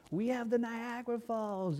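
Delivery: high, slow boast; bragging Canadian kid. Retold or imitated. Mimicking Canadian kid bragging about the falls, high and slow. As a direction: stretch it out like a proud child.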